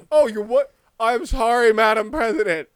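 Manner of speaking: a scolded grown man saying sorry; mock cowed apology.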